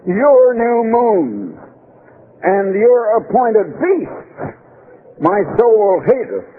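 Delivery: solemn reading with "Your" stressed. Shown as a demonstration. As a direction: drag out "Your" to accuse them. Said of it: "And I put the "your".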